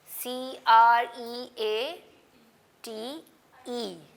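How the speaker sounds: letters drawn out one by one; slow spelling. Shown as a demonstration. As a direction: spell each letter slowly and loudly. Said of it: "spelling aloud slowly". (धीरे-धीरे अक्षर बोलना)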